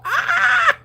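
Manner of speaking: high squeal of laughter; excited shriek. Real, not acted. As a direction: let out a squeal. Laughing outburst.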